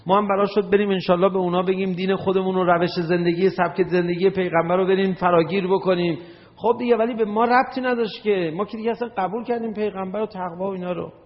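high, fast mock complaint; indignant protest. Acting out a listener's protest.

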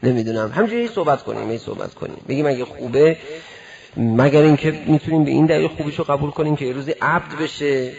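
ordinary delivery

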